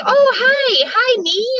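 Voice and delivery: high, lilting retold hello; mock surprised greeting. Host greeting a mystery panel guest, acted surprised hello.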